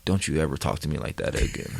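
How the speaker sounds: low, soft and quick retold threat; close-up stern warning. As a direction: pull him close and warn him quietly. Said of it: "pulls me close".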